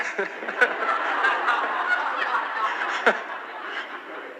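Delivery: breathy unvoiced laughter; laughing again. Real, not acted. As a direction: let the laugh roll out and fade. Keeps laughing at the joke.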